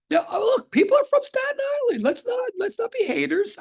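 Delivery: very high, squeaky put-on voice; playful falsetto protest; mock pleading. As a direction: plead in a silly high-pitched voice. Mock plea for Staten Island.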